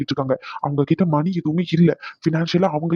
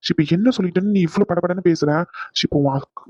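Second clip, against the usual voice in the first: ordinary talk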